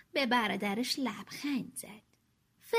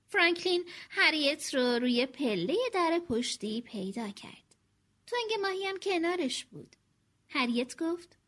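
usual speaking voice

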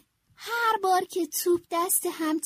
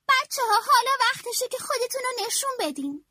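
higher, encouraging coach voice; 9 semitones higher than usual. A narrator's reading. Coach urging the team to show themselves, an encouraging, higher voice. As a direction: fire up the kids. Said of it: "مربی گفت".

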